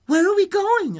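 put-on high, loud childlike question; high-pitched girl calling out mid-flight; little girl. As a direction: pipe up like a startled little girl. Little girl asks while flying.

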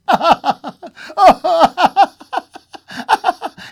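high, loud hysterical laughing cry; crying laughter. A narrator's reading. Wife overcome before kissing her husband, high hysterical laugh. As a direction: burst into high, sobbing laughter. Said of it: "she cried".

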